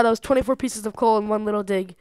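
normal talking voice

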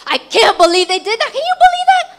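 high-pitched indignant rant; acted-out fuming disbelief; mad outrage. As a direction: storm in venting, pitch climbing sharply. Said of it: "I come home that's so mad".

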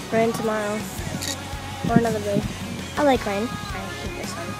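usual speaking voice

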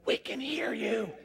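slow, sweetly teasing call; drawn-out, playful loving tone; mock loving. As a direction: lovingly let them know you overheard. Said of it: "I just lovingly said".

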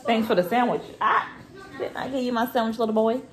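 ordinary delivery

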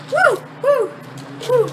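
loud high whoop; high woo from cold. Real, not acted. Shock of ice cold.

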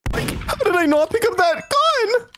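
high cartoon slacker voice; Shaggy. Streamer baffled about missing a gun, high cartoon impression. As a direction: strain up into a high cartoon pitch. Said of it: "my Shaggy voice is, like, kinda fading".

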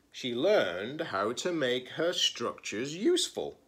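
lively picture-book read-aloud; story narration. Acted. Librarian reading a picture book to her students, expressive storytelling. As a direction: read it like story time for kids.

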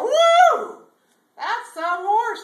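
very high, loud whoop, then slow admiration; thrilled whoop. Acted. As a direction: let out a huge cowboy holler of delight. Bubba thrilled by the stallion.

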